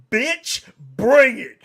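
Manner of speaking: high, loud mock yell of a challenge; wife popping off. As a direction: imitate a wife shouting for a fight. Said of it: "my wife pops off again".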